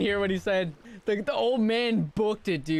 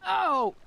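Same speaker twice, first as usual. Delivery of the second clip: hit hard crying out; 10 semitones higher than usual. Acted. Punched again.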